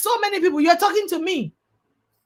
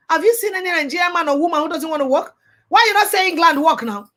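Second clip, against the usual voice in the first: normal talking voice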